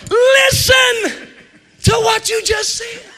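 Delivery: high, shouted preacher's cry; full-force preaching. Real, not acted. Preacher shouting at the congregation, full force. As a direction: hammer the point home to the church.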